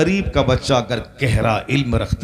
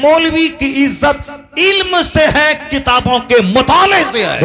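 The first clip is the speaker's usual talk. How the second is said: high-pitched rising sermon delivery; impassioned preaching; 11 semitones higher, 4 dB louder than usual. Real, not acted. Preacher telling the crowd where a cleric's honour lies, impassioned. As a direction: drive each point home, climbing in pitch. Preacher rises to full force.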